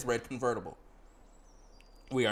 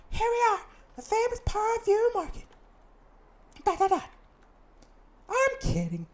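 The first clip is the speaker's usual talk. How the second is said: put-on bright girl guide voice, much higher; high companion voice; 4 dB louder than usual. Companion greeting the player in town, high voice. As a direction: play the chirpy companion up high.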